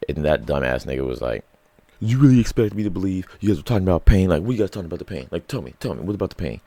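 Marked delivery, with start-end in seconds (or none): 2.0-6.6 fast, high, loud mock voice; imitating a dumb tough guy, put-on; hammed-up mocking aggressive questioning. Aggressor mocked in story.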